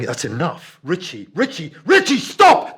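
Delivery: rising panicked yell, building to a scream; desperate screaming building up; panicked shout. Retold or imitated. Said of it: "And I'm going".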